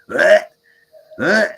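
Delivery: loud retching groans; dry heaving. Retold or imitated. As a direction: imitate a loud dry heave. Friend squats by garbage can.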